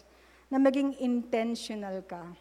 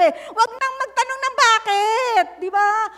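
raised, high, forceful; loud preaching; 8 semitones higher, 9 dB louder than usual. Real, not acted. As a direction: drive the point home loudly. Preacher raising voice on command. (malakas na pangangaral)

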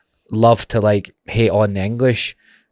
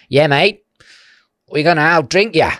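mock Aussie boast; 7 semitones higher, 5 dB louder than usual. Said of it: "they were like".

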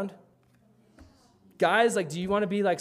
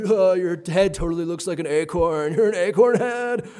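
mocking kids; 8 dB louder than usual. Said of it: "And they were like".

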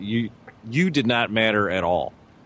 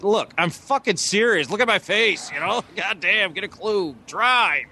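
higher, pushing, fed-up commands; insistent; 8 semitones higher than usual. Retold or imitated. Telling his wife to drive, fed-up and insistent. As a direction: demand she take it seriously.

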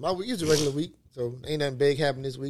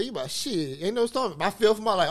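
usual speaking voice

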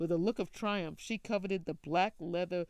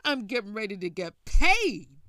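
much louder, triumphant whoop; gleeful; 3 semitones higher, 15 dB louder than usual. Acted. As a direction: shout it out like you won the lottery. Son gloating over valuable bear.

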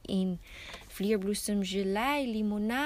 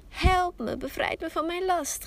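high, loud shriek for help; apple tree shrieking to be freed of its apples; 7 semitones higher, 5 dB louder than usual. A narrator's reading. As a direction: scream it out, desperate. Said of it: "gilde de op-, appelboom".

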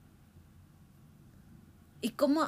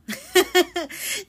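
risa breve; 8 semitones higher, 9 dB louder than usual. Real, not acted. Laughs at having no choice.

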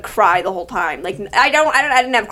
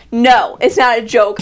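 ordinary delivery

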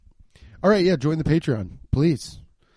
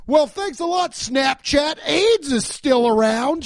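high, mock-indignant shout; sarcastic shout; 9 semitones higher, slower than usual. To listeners, mocking blaming apps for diseases with a sarcastic shout. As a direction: yell it sarcastically at the app.

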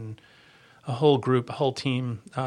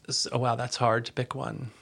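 usual speaking voice

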